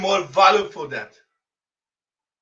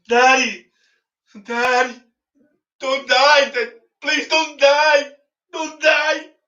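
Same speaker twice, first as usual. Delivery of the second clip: put-on high, rising child's plea that climbs higher; pleading child; 6 semitones higher than usual. Kids on the lake shore beg dying father, high pleading child voice. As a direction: beg like a small kid watching his father die.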